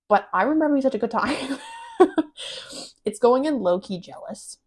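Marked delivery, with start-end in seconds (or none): 1.6-2.8 high giggle. Real, not acted. Laughing at the movie.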